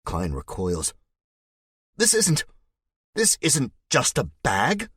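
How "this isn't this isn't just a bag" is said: shout. A narrator's reading. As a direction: shout it out defensively, clutching the bag. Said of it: "he shouts".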